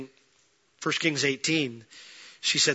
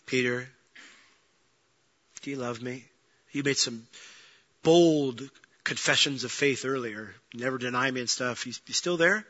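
softer, lower, tender asking; gentle questioning; 3 semitones lower, 4 dB quieter than usual. Retold or imitated. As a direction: ask him gently, no blame. Jesus gently questions Peter.

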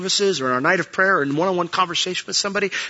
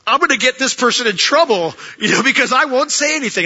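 higher, louder, frantic inner voice; panicked thought; 10 semitones higher, 5 dB louder than usual. Retold or imitated. Pastor reliving his panic at not speaking up, frantic and high. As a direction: blurt the worry out in a panic. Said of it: "And I'm thinking".

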